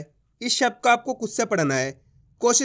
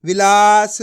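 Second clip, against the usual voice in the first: Vilas said at normal speed, louder; firm whole word; 6 dB louder, slower than usual. Shown as a demonstration. Teacher giving the blended word Vilas, louder. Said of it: "blended word".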